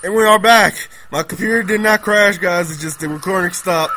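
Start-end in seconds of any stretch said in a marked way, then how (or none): 0.1-2.7 loud, high, excited greeting. Real, not acted. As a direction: burst back in with energy. Returning after recording stopped.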